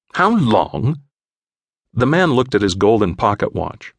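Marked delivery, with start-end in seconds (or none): 0.1-1.0 surprised English gent; puzzled English echo; English accent. A narrator's reading. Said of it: "English accent".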